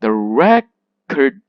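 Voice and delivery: records with loud, long REC and weak kurds; stress on first syllable. Shown as a demonstration. Teacher demonstrating the noun, loud stress on REC in records. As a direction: punch REC in records, slowly. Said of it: "go to the opposite".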